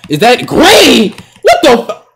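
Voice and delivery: high, loud disbelieving yell, slow; shocked yell. Real, not acted. Gamer reacting to a lucky green, shocked high yell. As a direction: stretch it out in disbelief. Amazed at shot.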